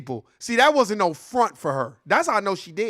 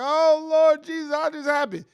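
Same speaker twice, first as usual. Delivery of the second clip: mock grieving churchgoer; 8 semitones higher, 3 dB louder than usual. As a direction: ham up a shocked mourner calling on Jesus. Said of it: "everybody be like".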